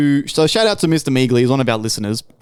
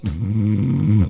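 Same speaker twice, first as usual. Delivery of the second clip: low mock snore; put-on rumbling snore; imitated snoring; 6 semitones lower than usual. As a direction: snore like a wife fast asleep. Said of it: "Like, snoring and shit".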